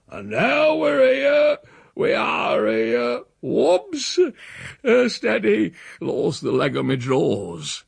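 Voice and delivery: put-on slow, high-pitched drunken bawl; devilish bawling. A narrator's reading. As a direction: bawl it out, stretching the words. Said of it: "bawling at the top of his devilish voice".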